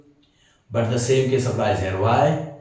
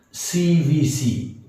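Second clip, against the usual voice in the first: slow letter-by-letter spelling; 5 semitones higher, much slower than usual. Shown as a demonstration. Teacher spells the term slowly for students. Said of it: "teacher spells out letters slowly".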